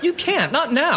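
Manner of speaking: high-pitched, agitated protest; hysterical. Acted. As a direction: stop them in a frantic burst. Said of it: "when you're hysterical".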